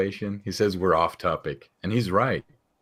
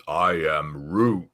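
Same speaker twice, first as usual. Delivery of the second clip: Groot voice; 2 semitones lower, much slower than usual. Retold or imitated. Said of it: "Mister Croatian says".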